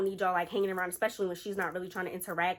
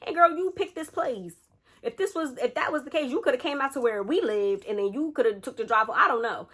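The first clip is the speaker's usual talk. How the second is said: higher, louder mock scolding of a friend; exasperated; 5 semitones higher, 6 dB louder than usual. Storyteller gripes at the friend who picked this place, exasperated. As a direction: give your friend an exasperated earful, pitched up.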